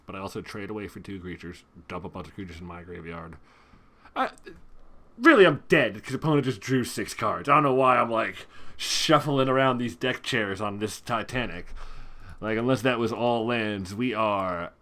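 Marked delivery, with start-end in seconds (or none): 4.2-14.7 loud frustrated rant. Real, not acted. Player realises he is dead, loud exasperated venting. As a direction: vent about losing the game.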